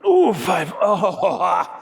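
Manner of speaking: high loud strained cry; straining. Real, not acted. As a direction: strain through the rep. Straining through count.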